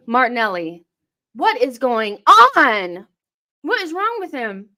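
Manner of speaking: ordinary talk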